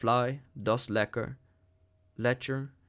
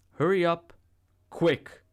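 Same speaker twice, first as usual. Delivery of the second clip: angry shout; 6 semitones higher, 5 dB louder than usual. A narrator's reading. Savage driving her out.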